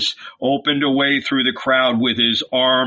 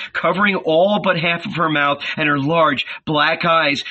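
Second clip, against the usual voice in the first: ordinary delivery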